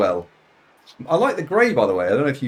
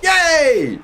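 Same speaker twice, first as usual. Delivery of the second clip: much higher, loud whoop; excited cheer; 11 dB louder than usual. Real, not acted. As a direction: celebrate the lucky roll out loud. Cheering game result.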